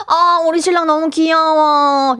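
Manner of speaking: slow, high-pitched gushing over a husband; doting coo. Shown as a demonstration. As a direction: coo it, drawn out, to coax him. 남편을 달래는 애교 시범. (애교 섞어 늘여 말하는 칭찬)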